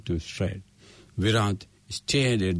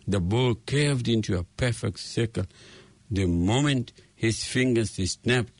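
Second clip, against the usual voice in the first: plain reading voice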